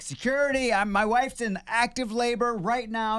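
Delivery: high, urgent panicked calls for help; frantic yelling. Retold or imitated. Husband bursting into the hospital, yelling for a wheelchair. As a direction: shout for security like a desperate husband. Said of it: "just start yelling".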